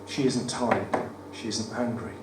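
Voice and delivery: usual speaking voice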